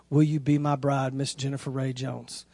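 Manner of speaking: low, soft proposal question, fading at the end; hushed, earnest recital; tender proposal. Retold or imitated. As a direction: pop the question quietly and sincerely. Retells reading the proposal question.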